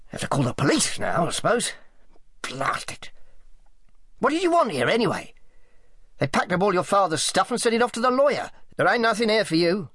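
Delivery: grumble, then send the visitor packing; grumbling, dismissive Selby. A narrator's reading. Selby tells Sally there's nothing for her, grumbling.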